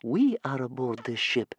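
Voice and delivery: put-on young boy's mild objection; small boy pointing out. A narrator's reading. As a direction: point it out meekly as a little boy. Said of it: "Bear Cub pointed out".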